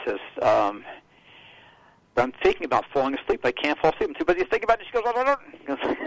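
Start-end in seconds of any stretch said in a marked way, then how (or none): 2.2-6.0 rushed, climbing higher as it goes; rattled-off retelling, ending high-pitched; rapid-fire caller. Retold or imitated. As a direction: imitate a caller racing through her worries. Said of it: "like I'm talking slow".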